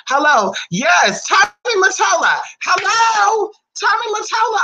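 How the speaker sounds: sudden excited high greeting; excited shout-out. Real, not acted. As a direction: call out his name like a hype host. Hyped shout-out to Tommy Mottola.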